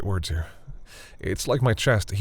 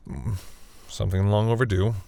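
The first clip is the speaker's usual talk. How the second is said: ordinary talk, nothing marked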